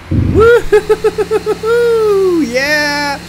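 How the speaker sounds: long high loud whoop; 1 excited whoop. Real, not acted. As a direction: whoop as the car flies. Jumping car off ramp.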